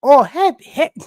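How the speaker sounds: high, loud, drawn-out mock woman; attempted imitation of her. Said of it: "No, I can't do it".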